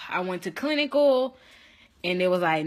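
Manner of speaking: ordinary delivery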